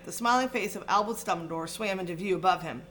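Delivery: ordinary delivery, nothing marked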